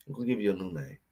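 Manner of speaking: softly, almost under his breath; quiet menacing. Acted. Repeats the threat to rival player.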